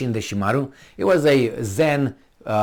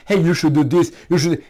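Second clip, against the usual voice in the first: acted lecturing; 5 semitones higher, 4 dB louder than usual. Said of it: "And say".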